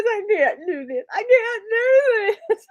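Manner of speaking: high, loud, laughing half-cry; mock tearful wail. Friend imitating her tearful student, mock tearful wail. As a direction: ham up a sobbing student. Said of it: "she was practically in tears".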